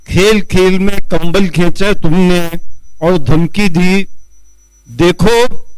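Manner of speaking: slow, measured Urdu nazm recital; poetry recitation. Acted. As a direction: declaim the verse line by line with pauses. Recites second nazm at mushaira.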